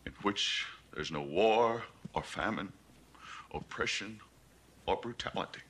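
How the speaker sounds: low voice fading toward a near whisper, long pauses; hushed evangelism. Acted. Selling Beale a perfect world to preach, hushed evangelism.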